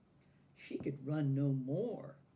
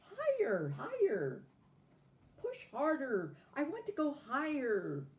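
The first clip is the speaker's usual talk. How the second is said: high, loud, greedy cries for more; demanding squeals; 10 semitones higher, 5 dB louder than usual. A narrator's reading. Doll on the swing demanding higher, high and loud. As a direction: push me higher, you can't stop now. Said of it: "the doll squealed".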